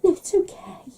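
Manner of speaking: high, unvoiced mock whisper. Mum whispers no to her daughter, mock secretive. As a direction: hush it out as a little secret.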